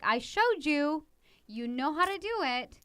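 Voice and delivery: put-on a parent insisting the child was already shown; firm parent reminder. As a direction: lay it down deliberately, word by word. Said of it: "you could be like".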